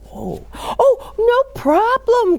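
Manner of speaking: high, eager cry; flustered villager, high and eager. A narrator's reading. Golda eager to help.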